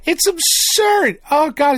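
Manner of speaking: high, slow cry of disbelief; high, drawn-out disbelief; exasperated rant. Real, not acted. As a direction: stretch it out in outraged disbelief. Ranting about school rules.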